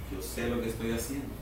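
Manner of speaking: put-on God calmly soothing his anxious child. Dios tranquilo en su trono, respondiendo con calma. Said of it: "tranquilo, sentado en su trono".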